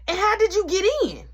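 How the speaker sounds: high, loud grandma demanding an answer; suspicious grandma. Retold or imitated. Nana grilling her grandson, high and demanding.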